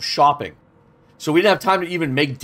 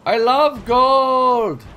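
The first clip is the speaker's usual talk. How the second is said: drawn-out put-on voice; 9 semitones higher, 4 dB louder, much slower than usual. Game character moment, drawn out.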